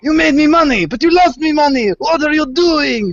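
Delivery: high, loud, squeaky angry boss; mock alien boss. Player mocking the alien boss over lost money, high and loud. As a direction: shriek at him like a swindled shopkeeper.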